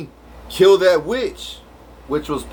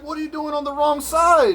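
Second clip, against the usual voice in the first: suspicious challenge; 11 semitones higher than usual. Retold or imitated. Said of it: "They're like".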